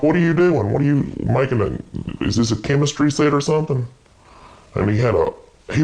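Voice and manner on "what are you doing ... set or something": exasperated shout. Retold or imitated. Sibling catching brother at a table, alarmed and raised. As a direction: fire off the questions at him in disbelief. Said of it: "And I'm like".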